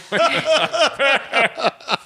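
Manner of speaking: long, high laughing fit; real helpless laughter. Real, not acted. Storyteller losing it over the first podcast, laughing helplessly. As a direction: crack up completely mid-sentence.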